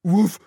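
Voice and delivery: loud rising woof. A narrator's reading. Mooch the dog answers with a loud woof. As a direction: bark it cheerfully. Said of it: "Mooch just said".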